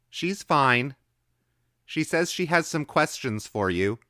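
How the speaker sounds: put-on high, loud young boy; child's voice. A narrator's reading. Boy calling his answer through the door, high and loud. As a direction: voice a small boy calling back. Boy's voice in the story.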